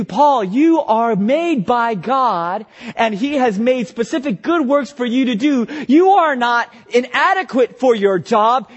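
rising, slowed emphatic preaching to his own soul; self-preaching. Acted. Preacher urging himself he is made by God, rising fervor. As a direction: tell yourself you are made for this.

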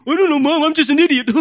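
put-on high, loud whiny kid, laughing; mock kid puppet voice. Parent voicing the kid's answer, whiny and laughing. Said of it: "through their voice, like a puppet".

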